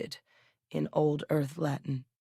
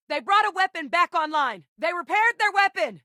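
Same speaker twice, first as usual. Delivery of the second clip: high, urgent yell; shouted battlefield alarm; combat shout; 12 semitones higher than usual. Acted. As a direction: call the warning out across a battle. Combat alert called out.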